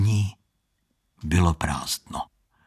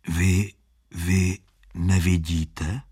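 timid question; 3 semitones lower, slower than usual. Acted. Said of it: "odvážil se Standa".

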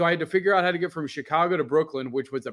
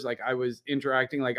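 normal talking voice

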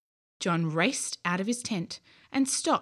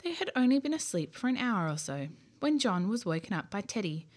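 plain reading voice

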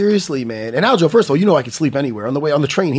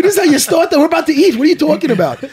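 higher and louder, mock outrage; shocked outburst; 9 semitones higher, 4 dB louder, faster than usual. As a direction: play up your disbelief at him. Retelling his shocked reply at dinner.

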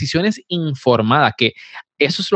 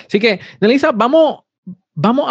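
Nelisa and vamos sung out much higher; playful urging; 10 semitones higher than usual. Acted. Host teasingly urging the guest Nelisa to start, pitched way up. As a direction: lift the name up high, teasing her to get going.

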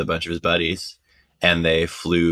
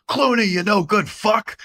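much higher, gruff mock insult to a friend; imitating Radio Man; 14 semitones higher than usual. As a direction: bark it up high like a cheeky old New Yorker. Radio Man heckling Clooney, voiced.

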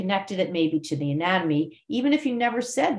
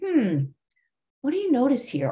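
musing "Hmm", a pause, then high "what do you notice here"; pondering inflection; 7 semitones higher than usual. Shown as a demonstration. Yoga teacher prompting students to notice, pondering inflection. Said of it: "I'm maybe saying".

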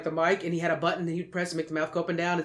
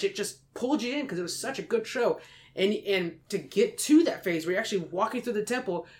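normal talking voice

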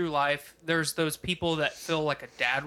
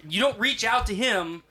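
raised, higher voice, playfully exasperated; dad-style scolding; 4 semitones higher, 4 dB louder than usual. Pretend. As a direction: scold your friend like a fed-up dad. Mock-dad scolding of his friend.

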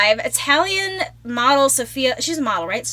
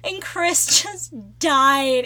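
normal talking voice

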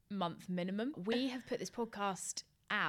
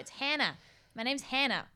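mock American accent, a little louder; 4 semitones higher, 4 dB louder than usual. Host imitating how she introduces herself in America, mock American accent. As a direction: say your name like an American would. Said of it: "in an American accent".

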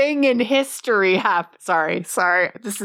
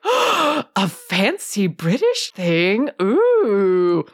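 mock awe, slow and drawn out with swooping pitch; gushing Teaboo; much slower than usual. Fan fawning over a fancy British thing, mock gushing. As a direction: coo it like an over-impressed Anglophile. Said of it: "only we are the Teaboos who are like".

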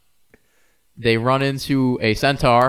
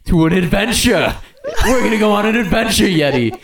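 excited; 8 semitones higher, 5 dB louder than usual. Acted. Sasquatch hypes an adventure.

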